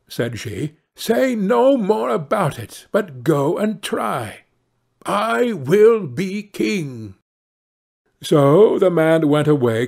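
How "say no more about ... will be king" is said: bossy wife. A narrator's reading. Wife voiced in the tale.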